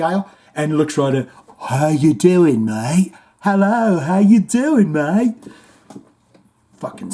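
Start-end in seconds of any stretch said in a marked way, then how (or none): 1.7-5.5 mock Australian greeting. Murray greeting the kid.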